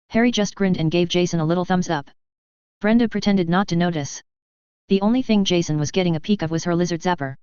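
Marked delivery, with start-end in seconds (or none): none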